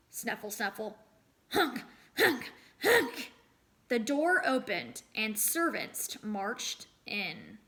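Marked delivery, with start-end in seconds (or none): 1.5-3.3 rising, loud honks; honking nose blows. Acted. Boy blows his nose for servants, honking. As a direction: honk it out like a goose.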